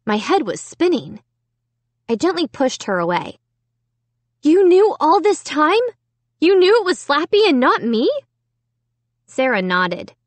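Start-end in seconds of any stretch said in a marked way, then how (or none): 4.4-8.1 shocked accusing. A narrator's reading.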